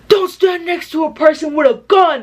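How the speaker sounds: high-pitched mock scolding; exaggerated scolding impression. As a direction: overact his warning in a high voice. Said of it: "he's literally saying it like this".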